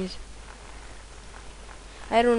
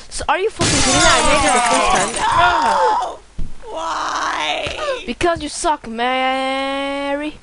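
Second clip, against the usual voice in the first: frustrated yell; 3 semitones higher, slower than usual. Real, not acted. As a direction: rage at the unfair game. Frustrated after failing level.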